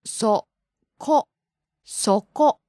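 ko, ko, koko built up one syllable at a time; slow syllable by syllable. Shown as a demonstration. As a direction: break koko into syllables, then say it whole. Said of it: "Please say them, [name] sensei".

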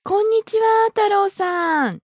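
huge-smile greeting. Acted. Stranger mistakes you for Taro, big smiling greeting. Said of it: "came up to you with a huge smile".

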